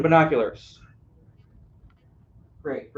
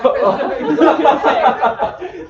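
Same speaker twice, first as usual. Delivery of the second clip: big laugh; 10 semitones higher, 9 dB louder than usual. Real, not acted. Audience laughing at shape joke.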